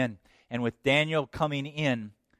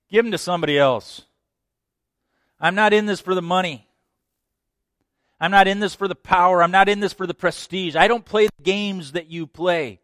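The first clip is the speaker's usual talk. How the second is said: loud, high, emphatic rejection; firm refusal; 7 semitones higher, 9 dB louder than usual. Acted. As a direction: shut down the bribe firmly. Daniel rebuffs the king's offer.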